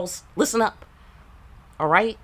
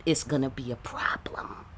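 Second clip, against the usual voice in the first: hushed, ominous whisper; warning whisper; 4 semitones lower, 6 dB quieter than usual. Real, not acted. Warning the women listening, in a hushed whisper. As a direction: drop to a whisper like a threat.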